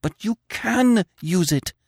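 high, slow, passionate plea; passionate argument. Acted. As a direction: argue with passion. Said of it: "she argued passionately".

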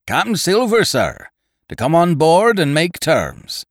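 high, loud hail from afar; pirate herald's high-pitched call; shouted announcement. A narrator's reading. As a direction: shout the message up to the fort. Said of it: "he shouted".

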